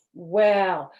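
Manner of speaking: ordinary delivery, nothing marked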